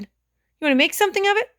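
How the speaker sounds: high, loud little tough guy. A narrator's reading. Six-year-old squaring up to Elaine, best tough guy voice. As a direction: puff up like a pint-sized tough guy. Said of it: "in his best tough guy voice".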